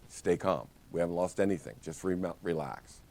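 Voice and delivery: soft and hushed, easing off; quiet reassurance after mock panic; calming. Shown as a demonstration. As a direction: talk someone down gently. Soothing the panicking agent.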